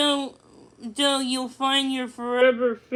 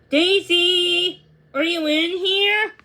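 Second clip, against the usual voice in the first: raised voice calling into the dark barn; 5 semitones higher, 4 dB louder, slower than usual. Acted. Millie calling for Daisy inside the barn, raised voice. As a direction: call out to find her.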